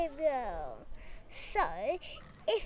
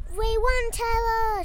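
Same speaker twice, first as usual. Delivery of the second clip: higher, louder victory cry; raised storybook cheer; shouted triumph; 5 semitones higher, 4 dB louder than usual. A narrator's reading. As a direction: call out the win to a friend. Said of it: "shouted Lily".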